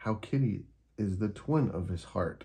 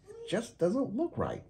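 high, sweet kitten voice; a little cat admiring her model; Kitty voice; 12 semitones higher than usual. Acted. Kitty character voice.

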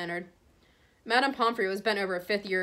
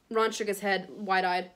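plain reading voice

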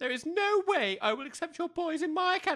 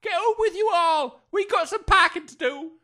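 furious scolding; 5 semitones higher, 8 dB louder than usual. Acted. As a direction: chase the boys off in a temper. Mother furious at boys.

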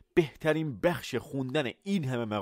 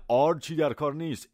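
ordinary delivery

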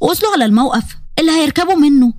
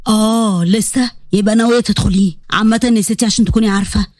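lower, fast, amused male voice; low teasing grown-up scolding a clumsy student; Yazan teasing gently; 4 semitones lower than usual. Acted. Yazan teasing Huda after she hits the wall, low and amused.

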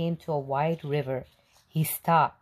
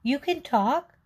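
put-on astonished old woman; 9 semitones higher than usual. A narrator's reading. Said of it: "said the little old woman".

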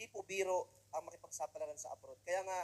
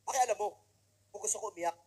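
louder, irritated comeback; mock snappy retort; 2 semitones higher, 8 dB louder than usual. OFW snaps at the nosy remittance teller, mock irritated. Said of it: "Sabi ko".

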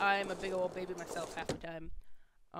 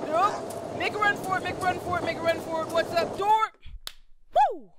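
higher and louder, thrilled sprint cheer; excited dash; 10 semitones higher, 8 dB louder than usual. Real, not acted. Gamer sprinting home through a blizzard, excited shouting. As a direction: cheer yourself on as you make a run for it.

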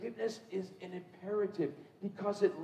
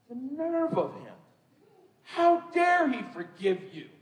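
high, loud, slow, hammed-up indignation; mock outrage; 7 semitones higher, 8 dB louder, slower than usual. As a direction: play the scandalised assistant, over the top. Mock-outraged assistant in retold story.

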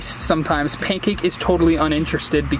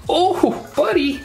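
high surprised cry; startled, pitched up; 11 semitones higher, slower than usual. Real, not acted. Surprised by bite.

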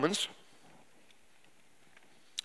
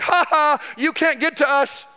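higher, sing-song jeer from behind city walls; mocking taunt; 7 semitones higher, slower than usual. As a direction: tease like a kid who's out of reach. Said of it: "They're like".

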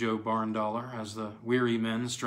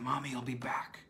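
put-on softer, hushed girl's voice; low tone; 6 dB quieter than usual. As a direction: murmur it to her brother. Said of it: "in a low tone".

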